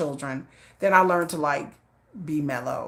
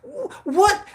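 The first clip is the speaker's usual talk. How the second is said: high, stammered disbelief; mock indignation; 3 dB louder than usual. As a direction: act out being thrown mid-fuss. Acting out her indignant reaction to husband.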